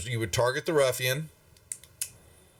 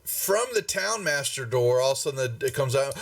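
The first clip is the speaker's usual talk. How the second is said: normal talking voice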